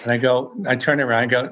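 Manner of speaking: usual speaking voice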